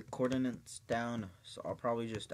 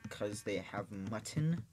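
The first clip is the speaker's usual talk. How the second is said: usual speaking voice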